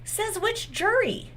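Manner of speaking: sarcastic challenge. Real, not acted. Sarcastic retort to commenters.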